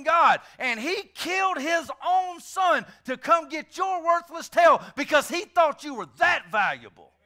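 high, loud, impassioned preaching; full-force preaching. Acted. Preacher insisting to the congregation, a fiery shout. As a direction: preach it with everything.

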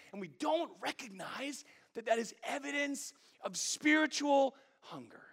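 high, loud, impassioned preaching; preaching at full force. Acted. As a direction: build to a fervent pulpit appeal.

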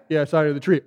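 low, offhand remark; casual. Retold or imitated. Jesus answers the amazed Nathaniel, casually. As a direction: toss it off as nothing special.

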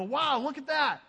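amazed onlooker. Acted. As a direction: gasp at the miracle like a fan. Looking at his miracles and go.

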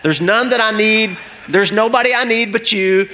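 high, slow, ringing declaration; stretched-out, exclaimed confession; impassioned prayerful preaching. Acted. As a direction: proclaim it to God with rising fervour. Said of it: "And I'm always asking God".

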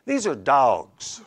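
slow, "dogs" stretched into "dawgs"; regional "dawgs". Shown as a demonstration. Preacher showing where he comes from, "dogs" drawn out as "dawgs". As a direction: say "dogs" with a long AW. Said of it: "D A W G S is how that is pronounced".

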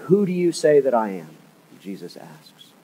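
normal talking voice

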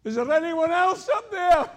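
high, loud shout upward. Pretend. Man below shouting up for another helper, loud. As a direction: yell up the cliff hoping for someone else. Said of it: "the voice from below shouted up".

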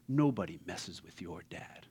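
low, soft, protective father voice; a proud dad reassuring his son, low; deep fatherly line. Retold or imitated. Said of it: "Mufasa says the coolest line".

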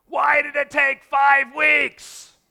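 high, slow, drawn-out exasperated yell; hammed-up outraged job seeker; exasperated shout. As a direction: shout the grievance out slowly at full volume. Frustrated job seeker's outburst.

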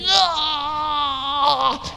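sudden high strained cry of effort. Retold or imitated. Old man straining to lift kettlebell, sharp pained cry. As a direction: cry out as the weight beats you.

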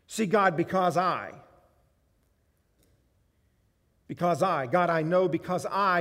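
loud, proud boasting to God; loud self-satisfied prayer; self-righteous prayer. Acted. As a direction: pray like a smug Pharisee showing off. Preacher voicing a self-righteous prayer.

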